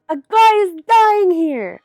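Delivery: high, loud, drawn-out yell; shouting back. A narrator's reading. Narrator telling sleepless neighbors off, high loud shout. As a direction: holler it slowly, stretching the key words.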